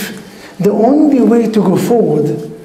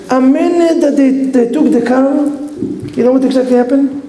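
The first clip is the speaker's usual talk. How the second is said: ordinary delivery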